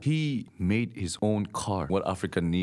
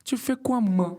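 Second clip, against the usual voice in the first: very high, sing-song quoi with a loud ma; Togolese tu fais quoi ma; 4 dB louder than usual. Retold or imitated. Showing friends the Togolese ma, very high and playful. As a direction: squeal it out like a Togolese auntie. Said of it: "It's not ma".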